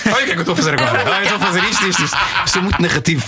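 high, giggly step-by-step narration; mock bedroom commentary. Shown as a demonstration. Telling guests how he narrates in bed, mock commentary. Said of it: "agora vou relatar".

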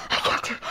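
a startled gasp, then a breathless whisper. Real, not acted.

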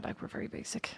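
mock whisper of a guilty secret; conspiratorial whisper; hushed confession. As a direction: drop to a half-whisper, admitting it. Mock confession of being basic.

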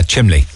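chimley said with an l, Cork style. Shown as a demonstration. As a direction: say chimley, not chimney. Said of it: "one of the big Cork ones is chimley".

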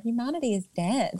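ordinary talk, nothing marked

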